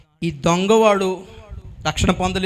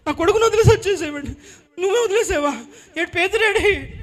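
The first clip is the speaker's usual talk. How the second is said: high-pitched acted confrontation; imitated high, accusing outburst; distraught accusation; 10 semitones higher than usual. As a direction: confront him as a hurt, aggrieved mother. Mother confronts disciple for abandoning son.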